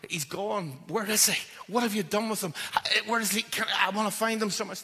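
high, loud frantic pleading; Mary crying out, frantic to find him; distraught cry. Retold or imitated. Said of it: "you know".

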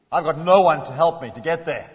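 loud, bitter complaint; an angry cripple's complaint. Shown as a demonstration. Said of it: "Was he angry?".

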